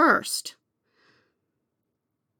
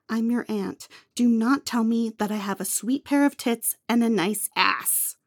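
ordinary delivery

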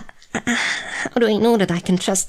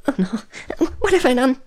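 alarmed; 8 semitones higher than usual. Acted. Sudden alarm at collapsed human.

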